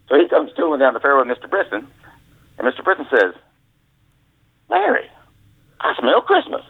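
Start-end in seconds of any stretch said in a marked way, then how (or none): 4.7-6.6 much higher mock boss voice; imitating the boss. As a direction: sniff it out suspiciously, high. Boss Brisson sniffs liquor.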